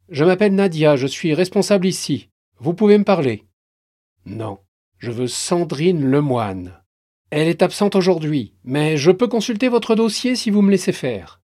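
plus haute et forte, tendue; responsable rassurante. Acted. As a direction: calmer l'homme armé en parlant. Responsable tente de calmer. (responsable d'agence tendue qui négocie)

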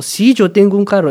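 showing Spanish intonation, "Sí" stressed. Shown as a demonstration. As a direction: hit "Sí" and fall. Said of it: "es más como".

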